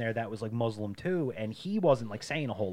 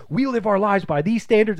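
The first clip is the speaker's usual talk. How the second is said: loud, high imitation of a zealous arguer; preachy retold protest, voice raised; mock righteous insistence; 9 semitones higher, 10 dB louder than usual. Retelling the Haitian kid defending his faith, righteous insistence.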